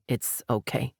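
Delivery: quietly. A narrator's reading. Colton comforting crying Laurel, speaking quietly. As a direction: hush her tenderly. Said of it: "Colton said quietly".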